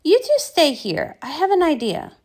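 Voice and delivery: plain reading voice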